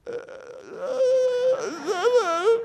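high broken sobbing gasps; breaking down crying. Retold or imitated. Man on live radio breaking down, broken high sobs. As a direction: choke out sobs on the phone. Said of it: "he breaks down crying".

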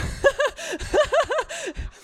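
high, loud peals of laughter; relieved laughter. Real, not acted. Mum relieved by her kids' answer, relieved laughter. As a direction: burst out in a long high laugh.

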